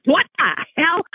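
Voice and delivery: 3 blurt it out in shock; 1 shocked outburst. Retold or imitated. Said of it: "I thought".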